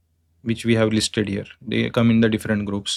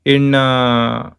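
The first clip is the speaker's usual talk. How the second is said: usual speaking voice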